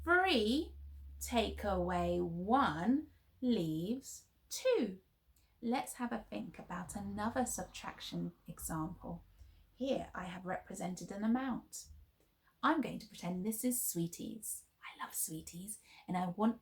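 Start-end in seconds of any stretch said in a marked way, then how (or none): 0.0-5.0 slow and clear. Shown as a demonstration. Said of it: "let's say the number sentence". 14.8-15.8 sneaky whispered confession; whispering. Acted. Playful confession to children.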